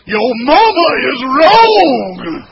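slow, high, booming mock shout; mock rebuke. The preacher hamming up a rebuke of his mother's advice, booming shout. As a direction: draw every word out in a huge scolding bellow.

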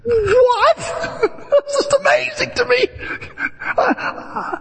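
high, laughing disbelief; high laughing amazement; astonished. Real, not acted. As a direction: react with delighted shock. Reacts to nine G.

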